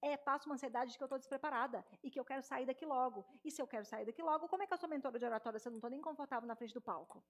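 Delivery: rushed, breathless-paced run of words; words tumbling out fast, no pauses; too fast. Shown as a demonstration. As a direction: rattle it off like an anxious speaker. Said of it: "começo a falar mais rápido".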